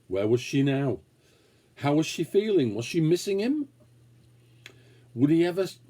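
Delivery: usual speaking voice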